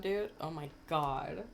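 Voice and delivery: ordinary talk, nothing marked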